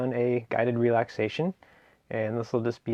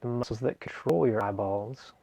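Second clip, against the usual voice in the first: guided meditation. Acted. Meditation teacher guiding listeners through a seated relaxation, guided meditation. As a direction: ease them gently through each phrase, leaving space between.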